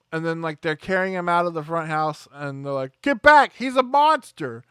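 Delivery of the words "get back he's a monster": high, loud warning cry; mock shouted warning. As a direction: order everyone back in mock alarm. Acting out officers warning people off.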